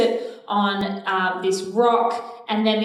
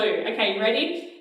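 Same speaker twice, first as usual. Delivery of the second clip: usual speaking voice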